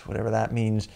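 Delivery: ordinary delivery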